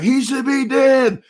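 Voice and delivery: put-on high, slow child's indignation; a little kid outraged at a cartoon; pissed-off kid. Young boy watching Bugs Bunny, high and slow with outrage. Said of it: "I used to look at the T V like".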